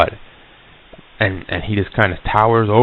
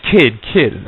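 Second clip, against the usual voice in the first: retold call, higher on each word; mildly raised calling voice; calling after someone; 4 semitones higher, 4 dB louder than usual. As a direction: call out to someone walking away.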